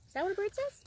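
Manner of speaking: plain reading voice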